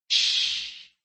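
soft, breathy hush; shush. Acted. Doctor silences the dying man, whispered hush. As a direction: hush him gently. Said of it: "the doctor said".

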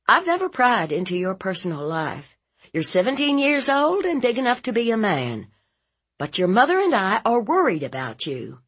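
high, quick lecture; concerned, insistent aunt. Acted. Aunt telling nephew she worries, insistent and quick. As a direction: press the worry on him urgently.

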